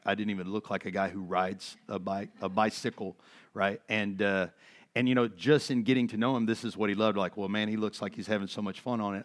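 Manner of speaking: ordinary talk, nothing marked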